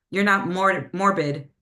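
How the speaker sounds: ordinary talk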